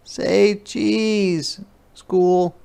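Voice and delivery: put-on high, cheerful sing-song girl; a child happily posing the clouds; little girl voice. Ruthie playfully asks the clouds to smile, high sing-song. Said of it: "She tried it out".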